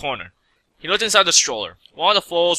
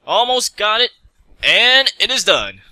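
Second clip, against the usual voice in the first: triumphant finish; 6 semitones higher, 4 dB louder, slower than usual. Acted. Mech finishes fixing the oven.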